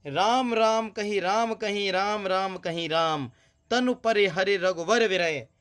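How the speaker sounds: slow, drawn-out verse reading; poem recitation. Acted. Teacher reciting the doha to students, slow recitation. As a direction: recite the doha slowly and sadly.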